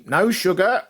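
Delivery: high, rising tea request; suddenly roused old patient. Retold or imitated. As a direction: pipe up, suddenly alive, pitch lifting. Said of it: "would come to life and say".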